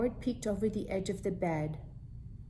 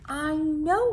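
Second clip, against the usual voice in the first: put-on boy agreeing; 9 semitones higher, 7 dB louder than usual. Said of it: "said Howard".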